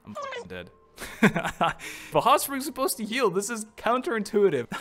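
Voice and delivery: high, loud indignant squeal; outraged disbelief. Real, not acted. Streamer squealing after the hot spring kills her. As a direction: protest the unfair death, laughing.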